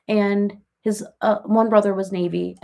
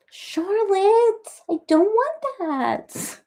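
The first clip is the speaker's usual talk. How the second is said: much higher whiny plea; high protest; 12 semitones higher, much slower than usual. Real, not acted. Begging the cat to stop licking, high whiny protest.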